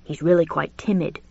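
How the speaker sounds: plain reading voice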